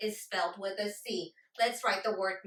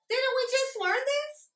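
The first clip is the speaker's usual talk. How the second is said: much higher, querying pupil voice; pupil's protest; 3 dB louder than usual. Acted. Pupil questioning the teacher about the lesson, high-pitched protest. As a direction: pipe up high as a pupil who knows this.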